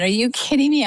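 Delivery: stunned, are-you-serious outburst; retold disbelief. Telling how she reacted to the no-mammal news, incredulous and high. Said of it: "I said".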